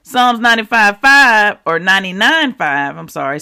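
ordinary talk

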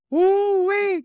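loud, high woo-wee cry; loud whooping laugh. Real, not acted. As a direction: burst out laughing, then whoop. Preacher laughs and exclaims.

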